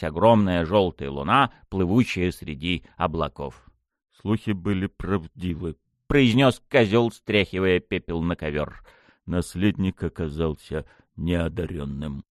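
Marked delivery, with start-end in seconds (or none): 4.2-5.8 low, soft-spoken villain; masked villain. A narrator's reading. Goat-masked man remarking to accomplices, low and quiet. 9.2-12.2 low, soft-spoken villain; masked villain. A narrator's reading. Goat-masked man remarking to accomplices, low and quiet.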